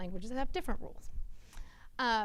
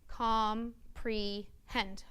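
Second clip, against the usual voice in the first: very slow, each syllable drawn apart; stretched-out syllables; much slower than usual. Retold or imitated. Child slowly sounding out a word for the teacher. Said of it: "and they go".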